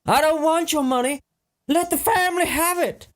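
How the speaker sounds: high-pitched insistent refusal; high protest. Acted. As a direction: protest the name and the money in a high voice. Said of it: "and Buck's like".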